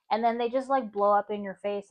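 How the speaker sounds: ordinary talk, nothing marked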